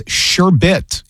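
"sherbet" slowly as SHER-bit, no second R; careful given pronunciation. Shown as a demonstration. Host reads out marketing's pronunciation, sherbet said slowly as SHER-bit. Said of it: "gave us pronunciations".

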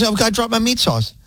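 lightly voiced coworker fretting over a mishap; imitating Danielle. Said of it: "that's not what she sounds like".

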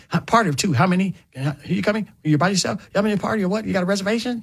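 lightly voiced restaurant host rattling off questions; quick, brisk mimic of a host greeting guests; host stand greeter. You know how they do it when you first come in.

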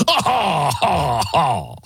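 drawn-out, falling "ha ha"; consumer's mock laugh. Consumer answering the company's privacy promise, mock laughter. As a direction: laugh it off in obvious mockery. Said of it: "says the consumer".

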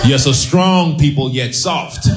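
mock proud. Mock proud tribe boast.

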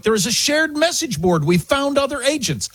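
high-pitched excited announcement; surprised excitement. Retold or imitated. Host quoting the agent's message to other agents, high and excited. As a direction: voice an amazed discovery, pitched high. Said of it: "expressing surprise and excitement".

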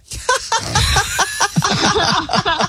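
high, helpless laughing fit. Real, not acted. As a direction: crack up at the pirate theme. Host bursts out laughing at pirate theme.

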